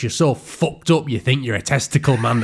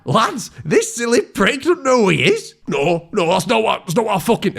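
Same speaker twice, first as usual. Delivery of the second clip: much higher, jeering tone; high mocking; 10 semitones higher than usual. Sting's gang jeering at Paul, high mocking voice. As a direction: hoot at him to the lads.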